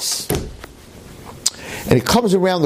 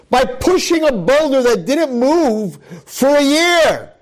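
dramatic storytelling build; 10 semitones higher than usual. Acted. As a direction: build the tension as he lifts.

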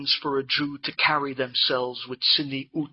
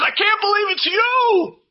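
even higher, loud joyful scream; excited shriek back; 5 dB louder than usual. Retold or imitated. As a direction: kala screaming back at the wife, ecstatic. Kala shrieking back at wife.

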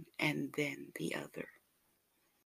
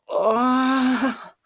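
much higher, louder drawn-out cry; an ecstatic cry; moan of pleasure; 9 semitones higher, 10 dB louder than usual. Acted. Lover moaning in pleasure, high ecstatic cry.

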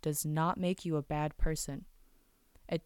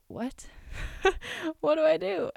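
higher and louder, lost and laughing; baffled panic; 11 semitones higher, 4 dB louder than usual. Pretend. Staring at the strain list, much higher baffled panic. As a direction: squeak out your confusion.

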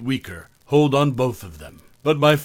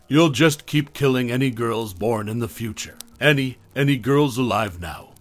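usual speaking voice

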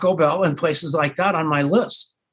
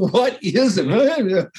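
slow, drawn-out pleading question; 5 semitones higher, much slower than usual. Retold or imitated. Caller pressing the reluctant ad man, slow and pitched high. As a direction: stretch every word, pitched high.

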